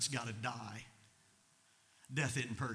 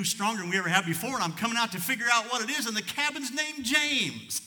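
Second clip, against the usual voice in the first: rising excitement; 10 semitones higher, 9 dB louder than usual. Retold or imitated. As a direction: let the joy build to the cabin's name. Climax of a moving story.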